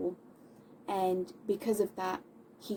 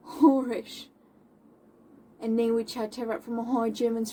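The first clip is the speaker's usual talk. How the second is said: Moll's indignation; 2 semitones higher, 7 dB louder than usual. Acted. Moll confronting her slanderer to his face, indignant and loud. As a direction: confront him in declamatory bursts. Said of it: "her describing how she feels about".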